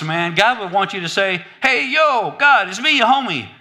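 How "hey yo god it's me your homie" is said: high, loud street-style greeting; Bronx guy praying. Acted. Bronx man greeting God in prayer, casual shout. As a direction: call out casually, as to a buddy. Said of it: "if you're from the Bronx".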